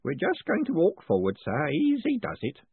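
ordinary delivery

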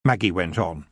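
plain reading voice

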